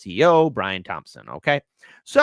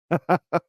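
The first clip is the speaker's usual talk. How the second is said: short amused chuckle; brief laugh at your own joke; quick little run of laughter; 5 semitones higher than usual. Real, not acted. Chuckles at his own aside.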